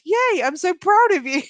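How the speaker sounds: high, loud mock cheer; gleeful cheering. Husband praising wife for coping, gleeful cheering. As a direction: gush with pride, pitched right up.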